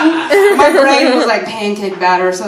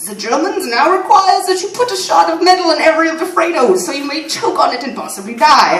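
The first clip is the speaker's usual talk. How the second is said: mock German accent, higher, joking; deadpan fake German announcement; lightly done German accent as a joke; 7 semitones higher, 4 dB louder than usual. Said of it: "slip in and out of the accent".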